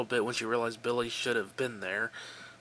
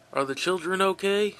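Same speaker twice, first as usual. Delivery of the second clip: upset; 7 semitones higher, 5 dB louder than usual. Acted. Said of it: "He sounded upset".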